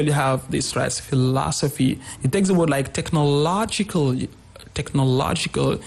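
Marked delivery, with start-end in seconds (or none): 1.0-2.0 "philosophy" with LOS stressed; second-syllable stress. Shown as a demonstration. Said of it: "On the second syllable". 2.9-5.8 "technological" stressed on LO. Shown as a demonstration. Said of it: "On the syllable low".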